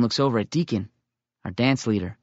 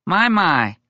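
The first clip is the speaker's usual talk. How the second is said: amused, higher and louder; chuckling; 7 semitones higher, 5 dB louder than usual. Acted. Little Coon chuckles at the boy's claim, amused. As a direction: say it through a chuckle. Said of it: "Little Coon chuckles".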